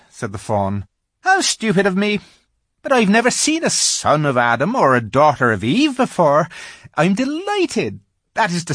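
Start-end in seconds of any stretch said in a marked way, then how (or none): 1.3-8.7 higher, lightly fussy faun voice; a nervous little faun asking politely; timid polite faun. A narrator's reading. Faun politely questions the girl, high and fussy.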